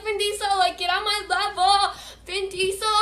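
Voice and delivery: high, loud re-enacted bragging; excited screaming. Rider bragging after the crash, excited screaming. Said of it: "I'm, like, screaming".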